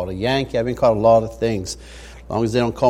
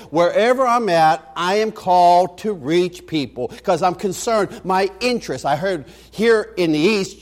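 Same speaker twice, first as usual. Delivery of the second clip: higher and louder, rising preacher's cadence; fiery preaching; 6 semitones higher, 5 dB louder than usual. Acted. Pastor urging the congregation to reach everyone, preaching hard. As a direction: preach it out, building to full force. Preaching builds to full force.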